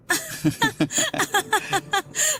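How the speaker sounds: high giggling laugh at his own joke; playful laugh. Acted. Boss laughing at his own flirty joke, playful laugh. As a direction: laugh off the cheeky line.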